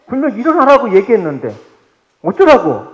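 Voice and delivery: loud furious retort; angry outburst. Acted. Child shouting back at a parent, furious. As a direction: snap back in a rage. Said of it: "화를 버럭".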